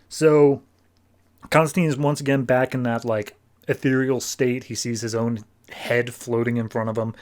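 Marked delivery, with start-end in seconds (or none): none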